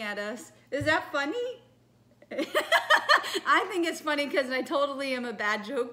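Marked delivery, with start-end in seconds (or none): 2.3-3.4 high, loud burst of laughter; loud self-amused cackle; real laugh. Real, not acted. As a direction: laugh out loud at your own botched joke. Laughing at own botched joke.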